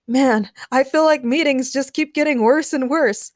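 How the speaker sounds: ordinary delivery, nothing marked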